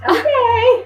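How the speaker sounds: high okay. Retold or imitated. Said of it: "and I was".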